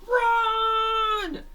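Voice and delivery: high, loud yell of Run; shouted warning. Acted. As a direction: scream it so the others flee. Character yells warning to flee.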